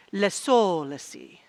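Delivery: mock-French coinage, stretched middle syllable; French accent. Shown as a demonstration. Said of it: "say with a French accent".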